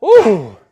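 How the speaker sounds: high, loud ooh of effort; straining ooh. Real, not acted. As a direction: let out a sharp ooh under strain. Strain at end of lift.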